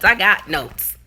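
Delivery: usual speaking voice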